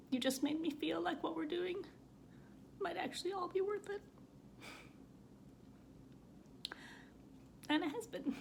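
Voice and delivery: fighting back tears as the words crack upward; crying. Real, not acted. Reading her own essay aloud, real tears, voice cracking high. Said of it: "I can't believe I'm crying".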